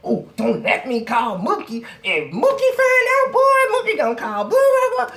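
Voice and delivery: high, loud mocking girl's voice; angry girl rant. Girl in the car threatening to call Mookie, high and loud. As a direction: squawk out threats about her cousin.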